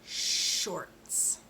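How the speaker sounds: shorts with a long unvoiced sh; careful shorts. Shown as a demonstration. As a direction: stretch the sh in shorts. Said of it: "One more time".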